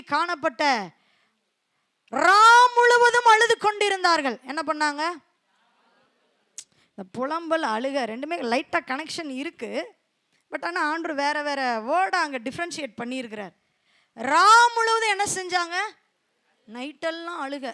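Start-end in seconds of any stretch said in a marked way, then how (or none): 2.1-4.4 loud, high scripture reading; scripture proclaimed. Acted. 14.2-15.8 loud, higher rhetorical question; preached question. Acted.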